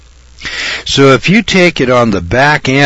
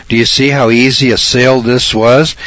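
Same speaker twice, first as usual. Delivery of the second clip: normal talking voice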